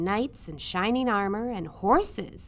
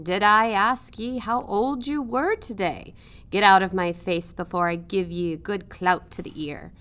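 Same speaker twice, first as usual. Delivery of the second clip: louder, rough threatening growl; gruff uncle scolding; 5 dB louder than usual. A narrator's reading. Uncle snapping at Midge on her birthday, threatening. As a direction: snarl at the child to go away.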